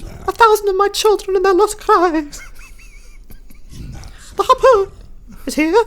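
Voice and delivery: shrieking high dramatic voice; high theatrical cry. Acted. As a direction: wail it like a doomed prophet. Hammy character cry continues.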